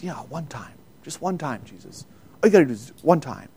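hushed, tempting whisper-like coax; quiet persuasive devil's pitch. Acted. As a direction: lure him in softly like a tempter. Devil's soft coaxing offer.

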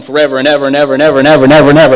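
loud and fast, words tumbling over each other; frantic rising rush of panic; loud racing loop. Retold or imitated. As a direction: rattle it off like a thought you can't stop. Testimony, reliving panic attack.

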